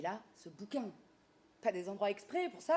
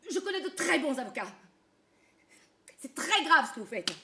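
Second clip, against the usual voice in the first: higher, louder outraged complaint; loud indignant protest; 6 semitones higher, 9 dB louder than usual. Acted.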